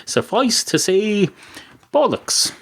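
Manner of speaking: bollocks flung out high; slow suffice to say, then a high bollocks; emphatic verdict. Shown as a demonstration. Host declares his verdict, slow, then a high bollocks. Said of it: "That's what it suffices to say".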